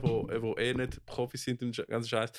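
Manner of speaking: usual speaking voice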